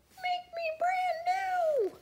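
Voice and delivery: very high, slow, tiny voice; melted snowman's voice. A narrator's reading. Snowman calling from the hot tub, tiny high voice. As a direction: pipe it up high and stretched out. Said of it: "A voice from the hot tub said".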